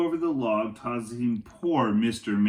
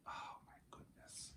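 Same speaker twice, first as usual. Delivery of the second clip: whisper; 28 dB quieter than usual. Acted. Teacher reacts to suspense.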